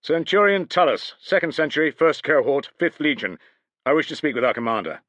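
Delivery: crisp loud centurion report; parade report. A narrator's reading. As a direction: announce rank like on parade. Centurion announces himself to guards.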